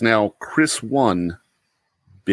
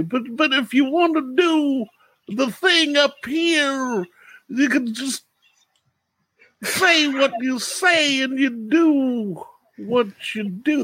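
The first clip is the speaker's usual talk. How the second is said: much higher, slow sing-song voice; Bill Cosby impression; slower than usual. Shown as a demonstration. A player showing the hosts his Bill Cosby impression, high and slow. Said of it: "I can do a Bill Cosby".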